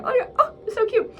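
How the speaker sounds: very high, melting coo. Retold or imitated. Reacting to cute old man.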